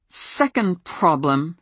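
slow, through gritted teeth. Acted. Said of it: "between gritted teeth".

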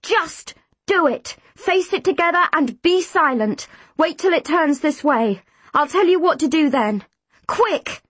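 high-pitched, pressing commands; insistent calling out; urgent orders. A narrator's reading. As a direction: drive them on urgently. Urgent orders facing the monster.

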